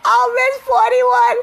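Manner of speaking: high, loud excited yelling; hyped shouting. Real, not acted. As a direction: shout it out, thrilled. Game-winning touchdown hype.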